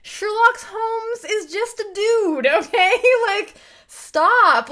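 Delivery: loud, high exasperated outburst; fed-up, high-pitched objection; exasperated protest. Retold or imitated. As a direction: push back loudly, dragging out the key words. Exasperated retort to the mocked opinion.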